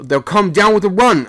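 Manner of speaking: high and loud, desperate; a sailor shouting in helpless fear. A narrator's reading. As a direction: cry out in helpless alarm. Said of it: "I shouted helplessly".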